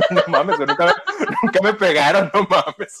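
laughing through the words; giggling while telling it; laughing it off, amused. Real, not acted. Laughing at own story.

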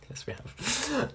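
slow breathy whisper. Real, not acted. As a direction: whisper it softly. Whispered reply.

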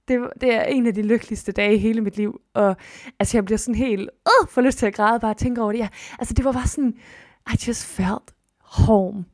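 ordinary talk, nothing marked

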